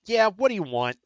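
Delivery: high, loud, brusque Boston clerk; Bostonian accent. Acted. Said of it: "a Bostonian accent".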